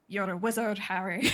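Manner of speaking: a hint of a Scottish giant; Scottish Hagrid line. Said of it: "my brain is just like".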